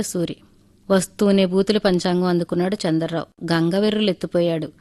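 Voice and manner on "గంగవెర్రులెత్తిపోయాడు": ordinary delivery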